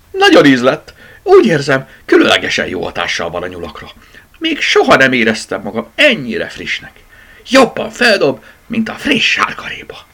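high, loud, bouncing enthusiasm; excited boasting. A narrator's reading. Rabbit fresh from eating the vitamin, boasting excitedly. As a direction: gush about feeling great.

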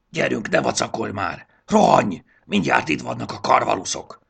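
loud, high panicked urging; urgent shouting. Acted. Triceps yelling at Jakab to run from the hawks. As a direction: hurry him before the hawks come. Triceps urges, storm approaching.

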